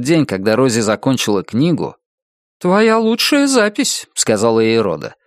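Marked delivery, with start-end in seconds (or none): 2.6-4.1 higher, drawn-out, admiring woman's voice; high-pitched character line, unhurried; Rhoda's praise. A narrator's reading. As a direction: praise her slowly in a lifted voice. Narrator voices Rhoda praising Rosie.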